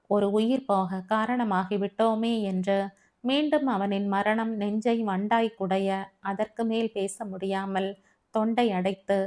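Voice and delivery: plain reading voice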